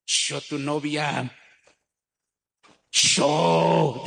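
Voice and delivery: lenta y altanera; humíllalo con desdén; muchacha soberbia. Retold or imitated. (la muchacha soberbia desprecia al muchacho, lenta y altanera)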